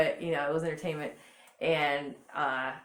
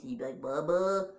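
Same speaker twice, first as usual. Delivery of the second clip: Smokey; 3 semitones lower than usual. Acted. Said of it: "he talked like this".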